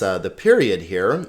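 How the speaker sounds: ordinary talk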